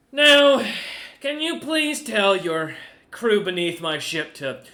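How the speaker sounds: loud, high-pitched haughty merchant; Goose the merchant. Acted. Merchant captain lecturing the party, loud and haughty. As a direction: lord it over them as the proud merchant.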